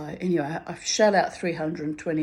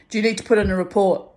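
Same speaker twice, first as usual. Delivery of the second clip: quick, louder official prodding; pushy sergeant; 3 semitones higher, 7 dB louder, faster than usual. Retold or imitated. Sergeant grilling her about a report, fast and loud. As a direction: fire the question at her fast.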